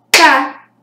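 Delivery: "Ca" as one short, loud beat; isolated syllable. Shown as a demonstration. Teacher counts the third voice emission, "Ca" loud and separate. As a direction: punch out "Ca" on its own. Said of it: "eu emiti a voz três vezes".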